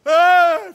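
sudden high loud yelp of fright; startled scream. Acted. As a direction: jump and scream. يفزع من شخص بعباءة في الظلام. (صرخة فزع)

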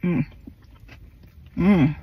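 muffled closed-mouth hum; eating while speaking; chewing with mouth full. Real, not acted. Seen 1: Burger in hand, cheeks full, lips closed and chewing during "mm.

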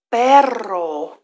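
usual speaking voice

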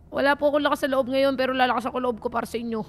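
ordinary delivery

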